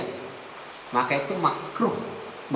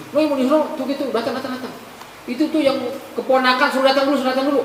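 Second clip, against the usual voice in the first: high, eager voice; lightly voiced dead sinner urging a wait; a hint of sly delight; gleeful stalling; 10 semitones higher, faster than usual.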